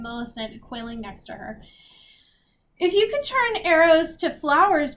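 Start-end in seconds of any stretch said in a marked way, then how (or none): 2.8-4.9 high, loud whiny plea; tearful. A narrator's reading. Beatrix begs Agatha to undo the ugliness, tearful. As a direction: wail it like a girl near tears. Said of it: "Beatrix said tearfully".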